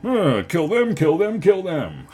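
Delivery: loud, high, drawn-out cartoon war cry; over-the-top villainous relish; mock bloodthirsty cry. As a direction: chant it with hammed-up glee. Acting out bloodthirsty drone strikes.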